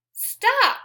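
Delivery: high, loud, sharp STOP; shouted stop. Acted. Teacher shouting the sign's command to the class, high and loud. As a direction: call out stop like a traffic light to kids. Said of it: "Traffic light says".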